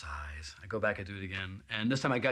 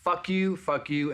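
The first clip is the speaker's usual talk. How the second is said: retold rant; 7 semitones higher, 4 dB louder, slower than usual. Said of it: "Just reamed them all".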